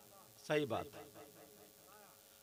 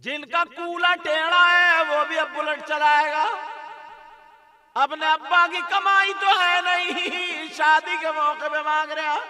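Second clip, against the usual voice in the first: ringing, sustained preacher's chant; full-force preaching; 10 semitones higher, 14 dB louder than usual. Real, not acted. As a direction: preach it at full volume, stretching key words.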